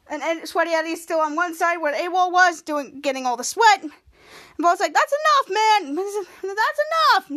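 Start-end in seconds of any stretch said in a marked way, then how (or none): 4.9-7.3 very high, louder cartoon plea; squeaky protest. Volt begs the villain to stop the sweat, very high and loud. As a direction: yelp it like a grossed-out teen.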